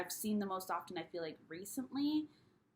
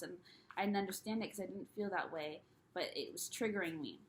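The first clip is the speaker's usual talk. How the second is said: ordinary talk, nothing marked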